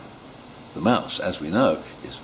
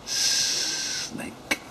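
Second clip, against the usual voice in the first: whispered reveal; 8 dB quieter than usual. Real, not acted. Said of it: "storyteller whispers reveal".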